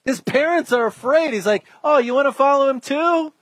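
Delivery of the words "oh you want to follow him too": higher-pitched teasing taunt; mock-innocent goading; smack talk. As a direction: needle them with a cheeky question. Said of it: "all this smack talk".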